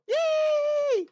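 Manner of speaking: high, loud cheering yay; loud happy yay. Real, not acted. Streamer greeting chat with a loud joyful yay. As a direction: cheer with delight.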